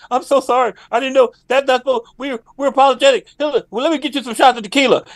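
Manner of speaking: a flustered new manager begging forgiveness; nervous manager. Retold or imitated. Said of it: "And he's like".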